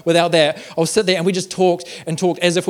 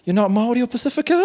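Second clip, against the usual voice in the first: hopeful question; 8 semitones higher, faster than usual. Retold or imitated. Voices caller's awkward hopeful question.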